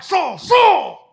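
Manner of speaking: loud, high, angry call of the name; angry shout. Shown as a demonstration. Preacher demonstrating an angry God calling Saul. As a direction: bark the name like an angry master. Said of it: "it's not out of anger".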